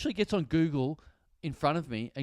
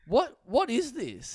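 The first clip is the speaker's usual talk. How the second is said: drawn-out, higher-pitched mock bafflement; baffled; 7 semitones higher, much slower than usual. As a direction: act out a slow, puzzled bank clerk. Retells baffled bank staff reaction.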